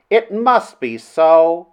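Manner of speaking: high, slow, drawn-out cry; impassioned cry. A narrator's reading. Princess yields to what Flynn says of love, impassioned cry. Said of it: "she cried".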